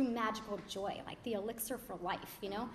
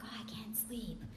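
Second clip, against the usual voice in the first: soft, slow whisper, playing innocent; whispered excuse; 6 dB quieter than usual. Caught by her dad stealing vodka, a whispered innocent excuse. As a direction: hush it like a kid caught at night.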